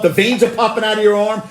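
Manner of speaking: usual speaking voice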